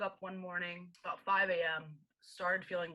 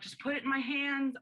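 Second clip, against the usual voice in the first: raised, higher, pushing the nurses to listen; urgent demand, pitched up; insistent plea; 6 semitones higher, 4 dB louder than usual. Retold or imitated. Pleading with triage nurses.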